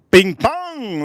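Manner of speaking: loud high chime call; bing bong chime. Real, not acted. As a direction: sing it like a doorbell. Announcing giveaway jingle.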